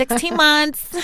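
high, drawn-out mock baby-age announcement; pretend proud parent. Guest gives the relationship's age like a child's, mock cooing. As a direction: stretch it out high, as if showing off a baby. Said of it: "I'm pretending like it's a child".

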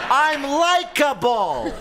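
high, slow, laughing mock candidate; mock Hillary voice. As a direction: sing out a mock campaign boast. Mock quote of Hillary Clinton.

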